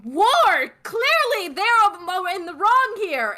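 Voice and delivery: high-pitched, indignant battlefield yelling; hammed-up scream at retreating troops; screaming in outrage. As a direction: cry out in high, acted outrage at deserters. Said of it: "they're screaming".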